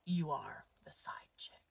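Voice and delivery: whisper. Acted. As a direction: lean in and whisper it to the women. Confiding aside to the audience.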